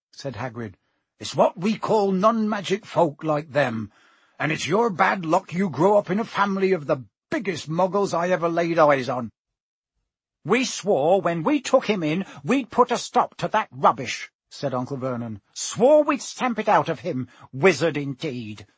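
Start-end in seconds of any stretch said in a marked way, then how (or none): 1.2-9.3 blunt giant explaining with scorn; rustic, forthright character voice; Hagrid. Acted. 10.4-18.7 indignant, blustering uncle; pompous outrage ending in contempt; Uncle Vernon. Acted. Voices angry Uncle Vernon.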